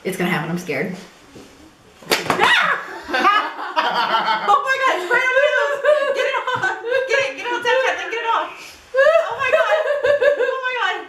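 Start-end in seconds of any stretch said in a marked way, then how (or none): 2.1-11.0 high, shrieking laughter; laughing while covered in cream. Real, not acted. She just got pied in the face.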